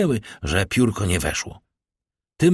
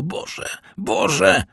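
usual speaking voice

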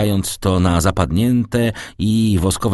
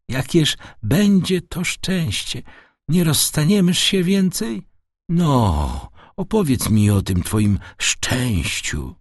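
put-on old father, hopeful; 8 semitones higher than usual. Father asking his son for the good news, hopeful. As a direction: ask as a tender old father, starting high.

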